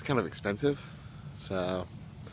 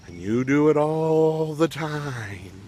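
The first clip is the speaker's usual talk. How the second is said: slow, higher, stretched-out scolding; teasing, slow sing-song blame; drawn-out accusation; 4 semitones higher, much slower than usual. Real, not acted. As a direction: draw it out to rub it in. Teasing accusation after "who does that?.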